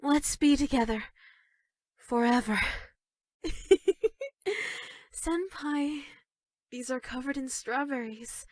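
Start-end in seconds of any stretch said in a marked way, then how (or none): 3.4-4.8 giggle. Acted. Giggle after promising forever.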